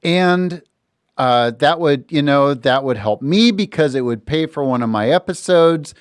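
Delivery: normal talking voice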